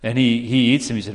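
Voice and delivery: ordinary talk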